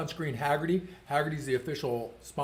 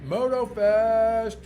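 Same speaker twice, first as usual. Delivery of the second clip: announcer voice; 8 semitones higher, 7 dB louder, much slower than usual. Acted. Announcing event name aloud.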